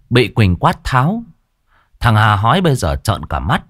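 ordinary delivery, nothing marked

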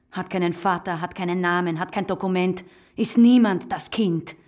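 bitter climax on "niemand"; bitter building list. Acted. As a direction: build the list to a crushing end.

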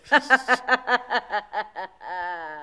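evil cackle. Acted. The evil fairy arrives laughing.

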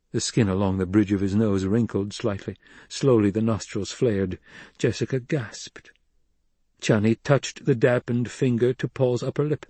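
ordinary delivery, nothing marked

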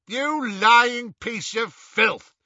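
slow, high, loud insult; angry shout. A narrator's reading. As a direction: shout it with drawn-out fury. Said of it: "shouted the wizard named Selwyn".

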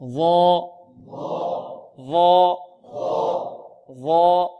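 heavy emphatic th in ظا, held on one note; sustained thick ظا for repeating; مخرج الظاء. Shown as a demonstration. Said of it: "انطقوا معي". (ظا مفخمة ممدودة للتلقين)

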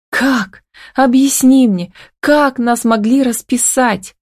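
high, upset demand for an explanation; pained, high-pitched questioning; fighting back tears. A narrator's reading. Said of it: "стараясь подавить слёзы".

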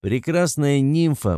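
«нимфа» растянуто и выделено; отец нарочно повторяет «Прекрасная нимфа» медленно; медленно, с нажимом. A narrator's reading. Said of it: "Кто-кто? переспрашивает сын". (с расстановкой)

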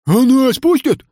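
high, loud question; clamoring question; eager plea. A narrator's reading. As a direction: call out hoping to be let in. Sick crowd pleading to join.

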